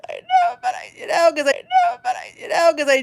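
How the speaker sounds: high, tearful sobbing voice; retold crying. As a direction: replay herself crying over a small plate of food. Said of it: "I'm like, crying".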